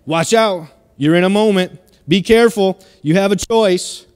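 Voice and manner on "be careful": usual speaking voice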